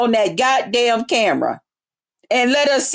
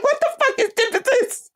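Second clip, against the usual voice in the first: high, laughing disbelief; squealing confusion; 11 semitones higher than usual. Retold or imitated. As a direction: squeak the question mockingly. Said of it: "I said".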